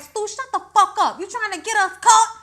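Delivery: high, loud hissing scold; imitating Billy scolding Stu.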